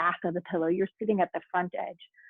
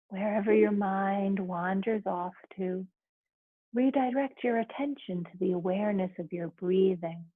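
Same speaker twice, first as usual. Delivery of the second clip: right in your ear; 3 dB quieter than usual. Acted. Leads a guided relaxation.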